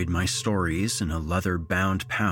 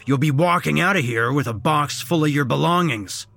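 loud, raised-pitch warning; threatening boss; 7 semitones higher, 6 dB louder than usual. A narrator's reading. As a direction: lay down a stern threat loudly. Boss threatens to fire him.

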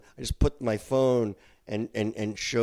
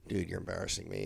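low, quiet disapproval; under-the-breath scolding, retold; embarrassed dad; 4 semitones lower, 10 dB quieter, faster than usual. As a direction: mutter it in mock disgust. Dad privately scorning complaining son.

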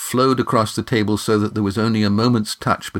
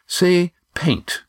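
correcting with dignity; a proud, dignified correction; 9 semitones higher than usual. Acted. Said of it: "said Gar with dignity".

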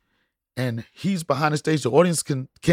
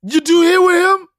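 high, loud mock indignation; indignant imagined question; 13 semitones higher, 7 dB louder than usual. Son turning to his mom after dad stole his joke, mock indignation. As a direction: demand it of mom, much higher and louder. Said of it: "looked at his mom like".

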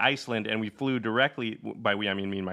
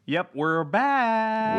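excited; 10 semitones higher, 3 dB louder, much slower than usual. Real, not acted. Drawn out high.

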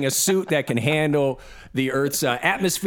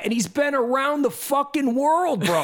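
much higher, worked-up disbelief; incredulous rant; 9 semitones higher than usual. Real, not acted. Host ranting about the well-travelled flat-earther, incredulous. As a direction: rant in high-pitched exasperation.

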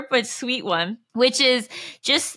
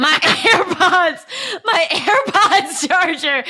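laughing through it; 5 semitones higher, 4 dB louder, slower than usual. Real, not acted. Reading absurd list, laughing.

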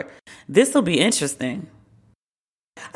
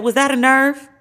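higher and louder sly jab; mock pointed retort; 7 semitones higher, 4 dB louder than usual. Mimicking the congressman's loud, higher comeback to the ranting colleague. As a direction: toss it off like a zinger. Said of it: "he said".

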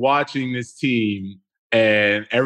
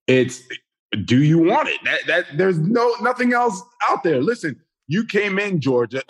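heated rant; 7 semitones higher than usual. Real, not acted.

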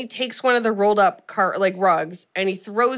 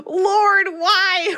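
high, wailing mock sob to the Lord; mock crying; 11 semitones higher, 3 dB louder than usual. Podcaster retelling her tears in the theater, high mock wail. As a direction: cry out to heaven in half-joking despair. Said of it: "I'm literally, like, crying".

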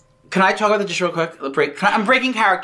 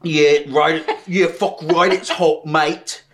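put-on Australian accent; 3 semitones lower than usual. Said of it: "I'm going back into character".

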